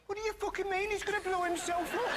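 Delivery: put-on loud, high-pitched Birmingham accent. Host imagining actor breaking character, high Birmingham accent. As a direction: exclaim it in a broad, high Brummie accent. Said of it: "you're from Birmingham".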